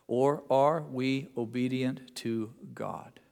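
ordinary talk, nothing marked

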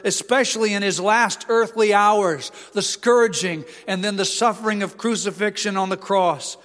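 mounting pulpit intensity; building preaching. Acted. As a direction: press the case harder and harder. Preaching rises to full force.

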